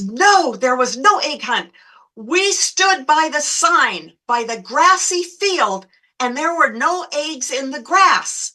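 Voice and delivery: slow, swooping, high-pitched complaint; indignant insistence. Retold or imitated. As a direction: argue back in mock outrage, stretching the words. Acted quarrel with the irate mother.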